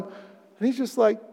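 normal talking voice

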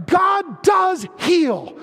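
very slow, high, loud declaration; climactic declaration. Acted. Pastor declaring to the congregation, slow loud climactic proclamation. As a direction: hammer each word home to the crowd.

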